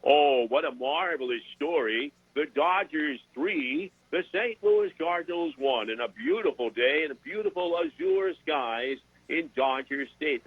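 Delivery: slow, drawn-out sportscaster impression; Vin Scully. Sports guest trying out an announcer he's working on, lilting sportscaster impression. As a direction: call it like a veteran baseball announcer, stretching each phrase. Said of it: "I'm working on is, is Vin Scully".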